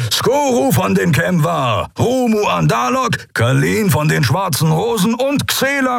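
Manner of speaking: captain arresting the admirals for treason; guard captain. Acted. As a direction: announce the charges with cold authority.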